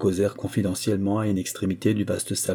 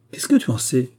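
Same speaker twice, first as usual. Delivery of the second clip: taken-aback mother, pitched up; astonished; 5 semitones higher, 5 dB louder than usual. A narrator's reading. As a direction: ask in mild disbelief, higher than usual. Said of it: "fit la princesse abasourdie". (mère abasourdie, ton plus aigu)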